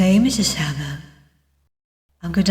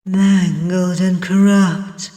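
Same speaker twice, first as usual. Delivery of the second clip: usual speaking voice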